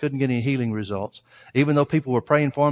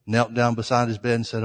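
ordinary delivery

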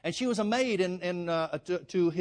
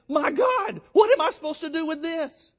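much higher and louder, a shout of alarm; panicked cry; 10 semitones higher, 7 dB louder than usual. Retold or imitated. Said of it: "tears his clothes and saying".

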